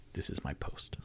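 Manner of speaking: whispered confidence; hushed aside to a friend. Acted. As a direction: breathe it out like a secret. Character's quiet aside.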